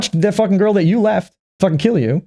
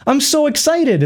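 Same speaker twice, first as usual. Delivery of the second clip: mock excited girl; 12 semitones higher than usual. Voicing the girl's reaction.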